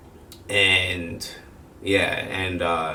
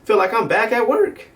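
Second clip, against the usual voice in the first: high, weary exasperation; exasperated; 11 semitones higher than usual. Retold or imitated. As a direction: sigh it out, fed up and tired. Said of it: "I was like".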